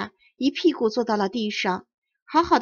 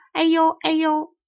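higher, slower, mocking his tug-of-war calls; imitating the boy's heave-ho; 6 semitones higher, 4 dB louder than usual. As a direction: copy the big boy teasing her. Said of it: "大荣君拉着这个说". (模仿大荣君拉辫子时的叫声)

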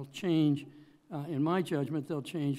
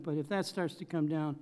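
normal talking voice

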